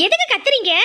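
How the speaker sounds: high-pitched, exasperated question; irritated retort. Acted. Wife answering her husband's shouting, irritated retort. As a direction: fire back, annoyed at being yelled for.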